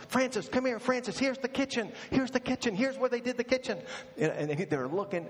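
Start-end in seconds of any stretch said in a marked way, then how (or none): 0.1-3.8 high, eager tour-group friend voice; high excited voice of a friend showing off a fake kitchen. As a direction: squeal it like a thrilled tourist. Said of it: "He says".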